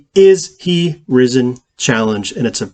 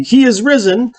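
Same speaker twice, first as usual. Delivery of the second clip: higher, proclaimed Easter greeting; minister's greeting; 9 semitones higher than usual. Acted. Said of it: "the minister will say".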